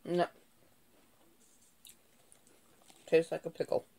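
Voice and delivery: talking while chewing chip. Real, not acted. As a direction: mouth partly full. Seen 2: Bites chip at 0.8, chews through 2.7, chip in hand.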